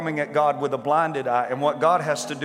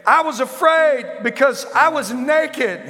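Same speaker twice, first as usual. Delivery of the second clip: high, loud and pleading; Adam's frightened reply; 10 semitones higher, 6 dB louder than usual. Acted. Adam answering God from hiding, high and loud with fear. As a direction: answer like a scared man caught hiding.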